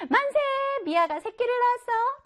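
high, jubilant announcement; acted crowd's hooray; joyful cheer. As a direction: celebrate good news in a high voice. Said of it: "동물들은 모두 기뻐했어요".

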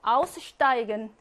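"Aussteigen" stressed on AUS; first-syllable stress. Shown as a demonstration. Teacher drilling separable verbs, "Aussteigen" stressed on AUS. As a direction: hit AUS at the start of "Aussteigen". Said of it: "분리동사는 일 강세".

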